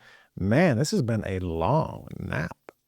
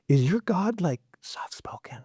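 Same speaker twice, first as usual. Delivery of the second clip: mock whisper; 5 semitones higher than usual. Mocking a quiet god.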